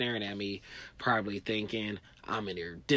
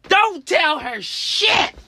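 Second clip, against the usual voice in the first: high, loud, slow warning yell; urgent inner warning shout; 14 dB louder, much slower than usual. Retold or imitated. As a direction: bellow a warning from inside your head.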